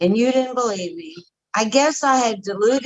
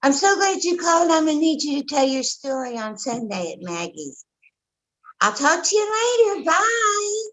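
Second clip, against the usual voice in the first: much higher, brisk cheery sponsor; Rosie's breezy voice; 8 semitones higher than usual. Retold or imitated. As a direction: chirp it fast and brush her off lightly. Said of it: "And she says".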